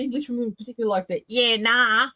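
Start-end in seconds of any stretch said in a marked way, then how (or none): 1.3-2.1 broad, loud Aussie "yeah, nah"; Aussie accent. Retold or imitated. Showing what English women dislike, broad Aussie accent. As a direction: put on a broad Australian twang. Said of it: "kind of accent".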